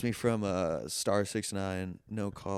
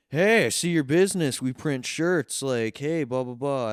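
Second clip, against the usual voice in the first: high, loud mock sales pitch; pushy salesman; 5 semitones higher, 9 dB louder than usual. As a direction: ham up the pushy phone seller. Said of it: "this guy going like".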